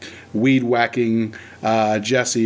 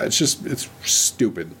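ordinary talk, nothing marked

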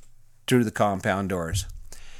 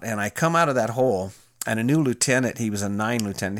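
plain reading voice

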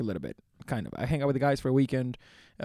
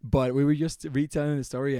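normal talking voice

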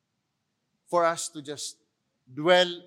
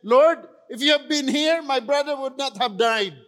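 Martha's anguished plea; 7 semitones higher, 7 dB louder than usual. Retold or imitated. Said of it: "she said".

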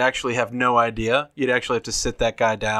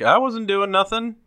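higher-pitched protest of innocence; feigned innocence; 9 semitones higher than usual. Acted. Smuggler caught at the roadblock denying everything, feigned innocence. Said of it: "you be like".

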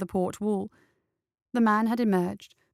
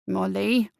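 muffled. A narrator's reading. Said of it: "A muffled voice interrupted".

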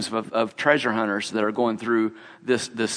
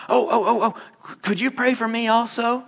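higher, eager stammered asking; eager plea; 9 semitones higher than usual. Retold or imitated. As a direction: jump in eager to be next, much higher. Another shopper begging for prayer.

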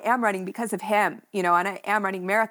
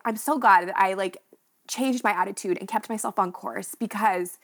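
normal talking voice

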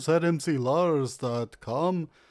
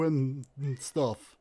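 usual speaking voice

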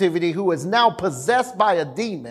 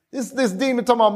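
usual speaking voice

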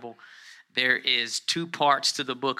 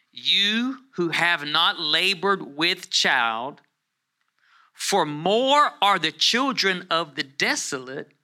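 loud, high and drawn out, phrase by phrase; scripture proclamation; 4 semitones higher, 5 dB louder, much slower than usual. Acted. Preacher reading Isaiah to the congregation, forceful slow proclamation. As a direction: declare it slowly to the whole room.

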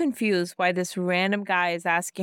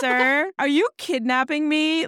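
incredulous, raised pitch; playfully affronted, pitched up; mock indignation; 6 semitones higher, 3 dB louder than usual. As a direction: challenge him in disbelief. Acted outburst at a stranger.

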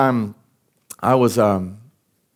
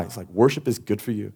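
ordinary delivery

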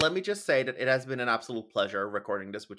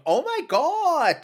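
a hint of a German child, high and slow; drawn-out high oh my God, mock child; 13 semitones higher, 5 dB louder, slower than usual. Imitating a German child on the street, lightly high and slow. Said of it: "that German child going".

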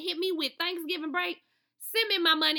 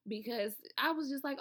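ordinary talk, nothing marked

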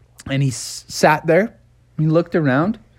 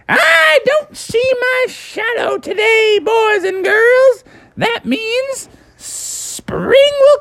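very high, slow proclaiming voice; grand groundhog proclamation; slower than usual. Acted. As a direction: proclaim spring to the crowd grandly. Phil addresses the crowd.

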